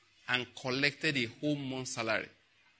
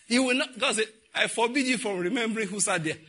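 laughing; 8 semitones higher, 3 dB louder than usual. Acted. Preacher voicing God, laughing.

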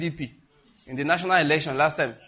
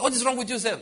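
mock scolding; 9 semitones higher than usual. Mocking people who judge.

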